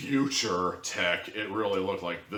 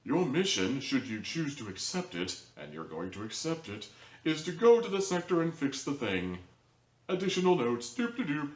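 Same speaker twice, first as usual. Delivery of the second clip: Omni Corporation notification; 8 semitones higher than usual. Pretend. Reviewer imagining the show's job alert, in a mock computer announcer voice. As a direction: play a hammed-up automated mission briefing. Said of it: "the Omni Corporation notification".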